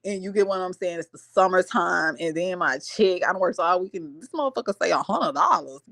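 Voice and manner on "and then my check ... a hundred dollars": ordinary talk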